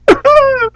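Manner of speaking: squeaky derisive laugh; high-pitched scornful laugh; high mocking laugh. Real, not acted. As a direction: cackle at your own joke. Laugh after mock.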